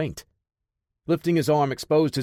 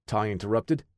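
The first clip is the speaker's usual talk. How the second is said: usual speaking voice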